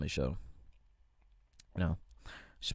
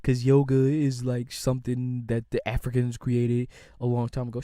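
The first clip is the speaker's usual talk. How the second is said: mock lecturing voice, slowed; 4 dB louder than usual. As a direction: play a smug objector, dragged out. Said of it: "people gonna be like".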